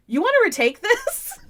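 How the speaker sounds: high, teasing mock question; amused staffer. Staffer teasing fan about a retake, high mock question. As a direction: lightly offer the retake. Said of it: "and he goes".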